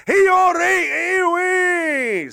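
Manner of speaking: high, loud Scottish street-brawler cry; mock Scottish accent. Scottish puncher lying in wait for a Canadian guy, high mock Scottish shout. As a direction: yell it as a hammed-up Scot. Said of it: "try a Scottish accent".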